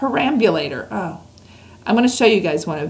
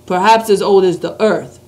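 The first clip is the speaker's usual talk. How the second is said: BFG giant voice; 6 dB louder than usual. Acted. Reads the BFG's lines.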